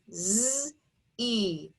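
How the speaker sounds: "Z-E" as a held zzz, then ee; sounded out. Shown as a demonstration. Teacher sounding out the second syllable, "Z-E" segmented into zzz, ee. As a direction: draw the sounds of "Z-E" apart. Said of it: "Second syllable".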